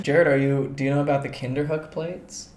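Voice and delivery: ordinary talk, nothing marked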